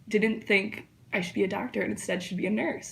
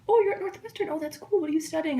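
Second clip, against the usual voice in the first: chatty Uber driver; 7 semitones higher than usual. Retold or imitated. Uber driver making small talk with a student, high mock voice. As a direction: pitch way up to mimic the small-talking driver.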